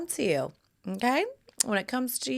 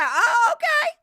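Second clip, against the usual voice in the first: oh okay, squealed much higher, half crying; thrilled squeak at his playful threat; breathless high-pitched excitement, louder; giddy okay; 13 semitones higher, 7 dB louder than usual. Retold or imitated. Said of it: "And I said".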